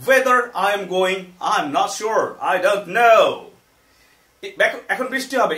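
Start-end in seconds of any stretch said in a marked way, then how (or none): 0.1-3.3 loud, slow model sentence; emphatic, loud teaching demo; projected example. Shown as a demonstration. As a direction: project each phrase to the class.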